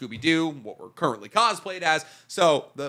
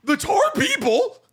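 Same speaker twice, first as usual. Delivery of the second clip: high character voice; 4 dB louder, slower than usual. Acted. Character voice joke.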